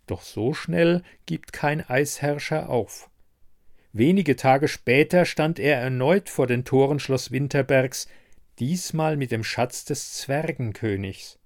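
ordinary delivery, nothing marked

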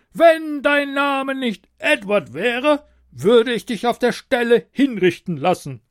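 roaring tyrant king. A narrator's reading. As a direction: threaten like a petty tyrant. King voiced by narrator.

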